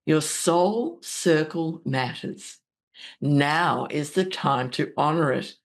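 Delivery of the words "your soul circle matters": ordinary talk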